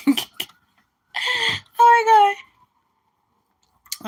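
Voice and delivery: a sudden high, loud cry on my; exasperated oh my God. Real, not acted. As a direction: blurt it out in disbelief. Exasperated outburst over the comment.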